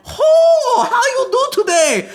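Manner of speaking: put-on very high, caricatured Chinese accent; hammed-up squeal in a fake Chinese accent; exaggerated mock Chinese accent, high and loud. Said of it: "Chinese person and be like".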